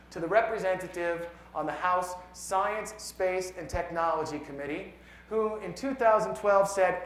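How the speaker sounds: measured, emphatic poem reading; poetry recitation. Acted. Poet reads a science poem to an audience, measured recitation. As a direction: recite it with weight on key words.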